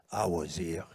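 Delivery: put-on low French-accented voice; weird French accent. Quoting a Catalan friend's words, in a weird French accent. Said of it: "I said it in a weird French accent".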